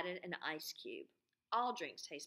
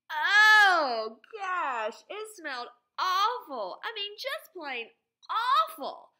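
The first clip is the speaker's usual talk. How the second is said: high, loud cry of disgust at the smell; disgusted boy; 12 semitones higher, 16 dB louder, much slower than usual. A narrator's reading. Teacher reading as Andrew reacting to the awful drink with loud high disgust. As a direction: squeal it out like a kid who just smelled something foul.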